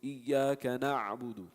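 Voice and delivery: slow Iyyaka na'budu, ayn held on sukoon; slow recitation, held ayn; resting ayn. Shown as a demonstration. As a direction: rest on the ayn of na'budu but keep it sounding. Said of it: "you're resting there, but you're still pronouncing it".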